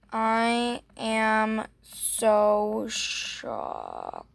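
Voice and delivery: very slow, stretched mock-shocked words; hammed-up shock. Staging shock for the gift giver, drawn out very slowly. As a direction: drag it out, playing shocked. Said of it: "really show her I'm, like, shocked".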